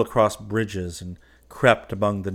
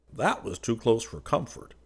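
mildly startled, pitch lifted; blurted out; 4 semitones higher than usual. A narrator's reading. Man reacting to a passing shell, blurting out. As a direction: let it slip out, a touch alarmed. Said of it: "he blurted out".

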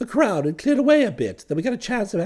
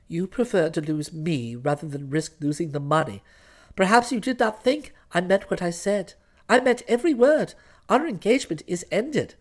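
quieter, controlled icy dismissal; stern fiancée; 6 semitones lower, 4 dB quieter than usual. Acted. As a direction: end it coldly and firmly. Florence breaks off the engagement.

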